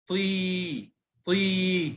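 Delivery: طي with a long, held "ee"; long vowel. Shown as a demonstration. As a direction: stretch the vowel in طي. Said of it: "مد بال".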